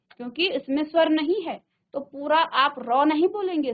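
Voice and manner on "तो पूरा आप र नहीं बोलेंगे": ordinary talk, nothing marked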